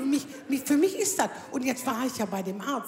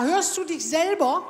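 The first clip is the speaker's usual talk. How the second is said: incredulous retort; 4 semitones higher, 5 dB louder, slower than usual. Retold or imitated. Indignant retort to doctor.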